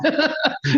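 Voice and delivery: high laugh. Real, not acted. Laughing at the quoted admission.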